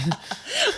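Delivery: high-pitched amused laugh; real giggling laugh; burst of laughter. Real, not acted. Amused at own joke.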